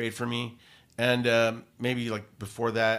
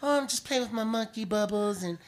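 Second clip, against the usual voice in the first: sweet and high, playing the star asking a favor; 10 semitones higher than usual. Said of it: "I would do a voice".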